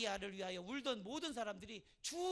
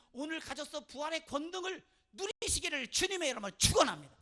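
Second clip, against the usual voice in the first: loud, raised pulpit proclamation; fervent blessing; 5 semitones higher, 9 dB louder than usual. Real, not acted. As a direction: build to a ringing blessing. Preacher's blessing at a climax.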